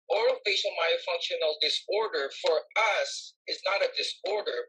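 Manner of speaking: usual speaking voice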